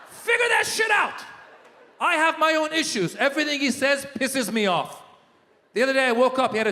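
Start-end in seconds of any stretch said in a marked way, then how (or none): 0.1-1.2 slow, high-pitched shouted order; exasperated shout. Acted. Comedian scolding Trump-voting friends, slow high exasperated shout. 2.0-5.0 mock angry rant. Comic rant about Trump.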